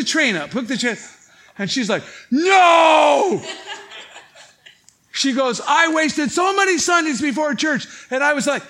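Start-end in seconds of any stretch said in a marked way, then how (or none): 2.3-3.4 loud, high cry of protest; shocked shout. Retold or imitated. Woman protests in the retold scene. 5.7-7.9 loud, high complaint; exasperated shout. Retold or imitated. Woman complains in the retold scene.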